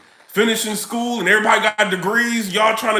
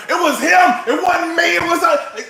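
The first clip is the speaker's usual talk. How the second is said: snitching shout; 8 semitones higher than usual. Retold or imitated. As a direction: point the finger with a yell. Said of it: "turning into Snitch Nine".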